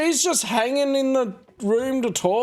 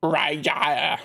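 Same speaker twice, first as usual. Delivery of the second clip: Raja stretched out with a put-on accent, said as rye-yah; accented name; 6 semitones lower than usual. Shown as a demonstration. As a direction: try Raja with a foreign accent. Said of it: "should I be giving him this accent".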